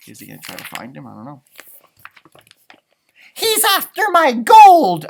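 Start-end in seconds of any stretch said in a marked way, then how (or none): 3.3-5.1 high, loud alarmed leprechaun; panicked cry. Acted. Leprechaun crying out in fear over his gold. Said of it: "I cried".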